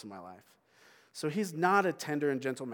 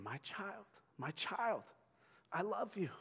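higher, quiet, tender; soft divine whisper; 6 semitones higher, 6 dB quieter than usual. Pretend. God to the church, a quiet tender voice. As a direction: say it softly like a loving father. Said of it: "He's saying".

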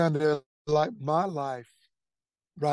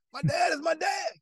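much higher, almost yelled declaration; emphatic outburst; 13 semitones higher than usual. Retold or imitated. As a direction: shout it like it's obvious. Emphatic aside in retold thoughts.

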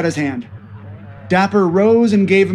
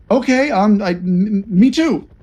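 nervous hesitant; 4 semitones higher than usual. Acted.

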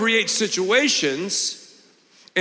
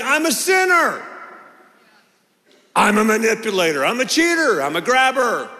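confessing shout; 3 semitones higher than usual. Acted. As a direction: cry out each sin like a confession. Jacob confessing his true name.

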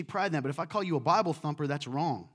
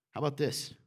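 ordinary talk

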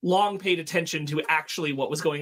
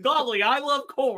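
golly shouted high and bright in a hammed-up farm-boy voice; hammed-up aw-shucks hero, pitched up; high hammed-up Superman; 10 semitones higher, 4 dB louder than usual. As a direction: play a hammed-up corn-loving Superman, higher. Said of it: "the same thing".

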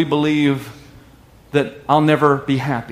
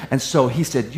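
normal talking voice

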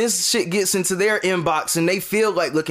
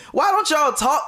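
normal talking voice

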